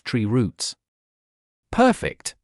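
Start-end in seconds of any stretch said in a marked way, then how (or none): 1.7-2.3 high, delighted outburst; pleased with the find; excited. Acted. Finds a perfect hiding spot.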